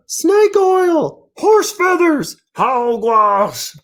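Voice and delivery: very high, slow, shouted cartoon outrage; outraged mayor. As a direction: sputter in pompous indignation. Mayor finds nonsense speech.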